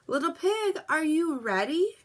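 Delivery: wolf calling on the pig early; sly wolf calling. A narrator's reading. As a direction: call sweetly through the door. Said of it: "asked the wolf".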